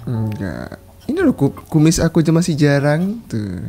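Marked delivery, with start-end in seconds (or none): none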